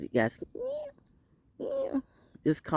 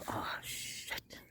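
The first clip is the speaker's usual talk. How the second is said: hushed dismayed whisper; whispered oh shit; 15 dB quieter than usual. Retold or imitated. To herself at the locked door, a whispered curse. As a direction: breathe it out in dismay. Realizing keys locked inside.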